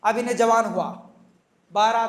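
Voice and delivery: ordinary delivery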